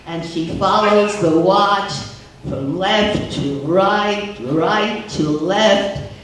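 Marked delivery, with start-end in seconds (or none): none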